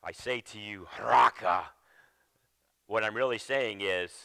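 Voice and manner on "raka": "Raka." spat out with contempt; scornful insult. Shown as a demonstration. Preacher acting out the insult to a brother, scornful. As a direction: sneer "Raka." at them like an insult. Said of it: "the tone of your voice".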